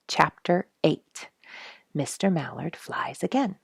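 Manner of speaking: ordinary delivery, nothing marked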